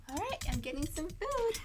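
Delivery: ordinary talk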